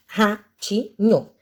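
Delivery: "Ratinho" in three slow, detached syllables. Shown as a demonstration. Teacher reviewing the parts of a word, "Ratinho" said syllable by syllable. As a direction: break "Ratinho" into its syllables with pauses. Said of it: "tenho três partes nessa palavra".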